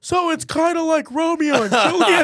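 high, loud teasing singsong; mock innocent comparison. Students mocking teacher in class.

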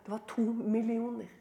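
soft, held-back delivery; hushed reveal. Real, not acted. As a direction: let the figure out quietly. Reveals figure quietly, then repeats.

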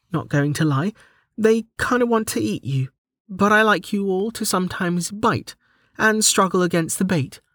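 high-pitched heroine, mildly stern. A narrator's reading. Delta lectures her fish, mildly stern high voice. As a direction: lay down the facts with mild sternness, high voice. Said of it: "Delta said with a stern voice".

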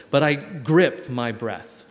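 ordinary talk, nothing marked

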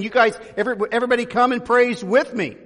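loud, high call to join in. Retold or imitated. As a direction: call everyone to praise.

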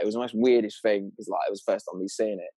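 usual speaking voice

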